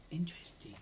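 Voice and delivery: breathy whispered interest; whispering. Real, not acted. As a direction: whisper it to yourself. Muses over store item, whispered.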